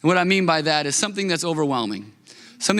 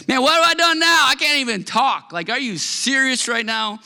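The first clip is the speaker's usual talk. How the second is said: exasperated younger brother; 7 semitones higher than usual. Retold or imitated. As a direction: play the wronged kid brother. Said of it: "He's like".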